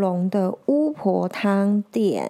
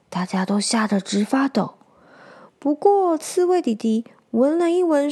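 usual speaking voice

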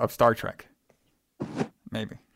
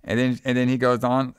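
usual speaking voice

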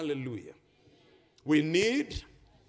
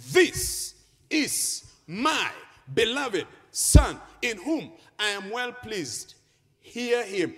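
slow, raised preacher's proclamation; booming proclamation; 2 semitones higher, 6 dB louder, slower than usual. Acted. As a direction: declare it as God's voice from heaven. Proclaims God's voice from the cloud.

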